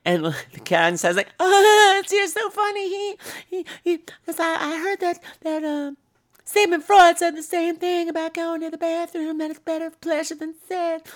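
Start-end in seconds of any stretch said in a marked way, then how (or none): 1.4-11.1 very high, breathy falsetto giggle. Retold or imitated. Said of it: "what sounds like [name]".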